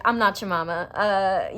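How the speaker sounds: ordinary talk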